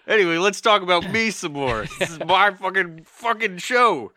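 high, loud, hammed-up self-importance; mock arrogance. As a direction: play the egomaniac host, laughing. Mock self-centered host.

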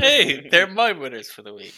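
loud, showy boast; mock boast. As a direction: crow it out loud like a proud winner. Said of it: "So I said".

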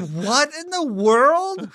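loud, high, drawn-out retold astonishment; outraged disbelief. Father retelling a restaurant row, in outraged disbelief. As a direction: stretch it out in indignant amazement.

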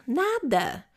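ordinary talk, nothing marked

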